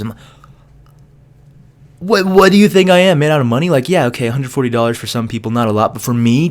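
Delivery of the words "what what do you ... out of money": indignant outburst. Retold or imitated. Indignant retort to price.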